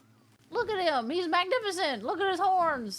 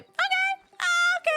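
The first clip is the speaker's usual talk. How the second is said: high squeak of hurt surprise, louder; squeaky okay; 5 dB louder than usual. Real, not acted. Told to back off, squeaking out a hurt okay. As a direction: yelp the okays up high like you've been stung.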